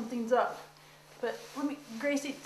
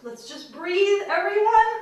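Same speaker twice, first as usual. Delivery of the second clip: louder, higher plea for calm; strained calming call; 8 semitones higher, 8 dB louder than usual. Real, not acted. Owner begs the cats to calm down, loud and strained. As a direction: try to settle everyone while panicking. Chaos with second cat.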